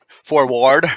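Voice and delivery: forward, laughing through it, the ward end loud; laughing pronunciation. Acted. Co-host trying the word through laughter, loud. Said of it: "so is it ward, not ward?".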